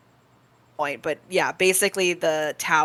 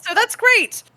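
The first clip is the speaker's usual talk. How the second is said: voice cracking; 4 dB louder than usual. Acted. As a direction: voice cracks high on "great". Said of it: "your voice cracks as she says the last part".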